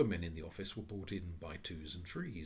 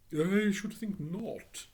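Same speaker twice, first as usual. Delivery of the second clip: higher, louder, slow proud voice; the phoenix answers haughtily; 12 semitones higher, 9 dB louder, much slower than usual. Acted. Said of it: "with pardonable pride".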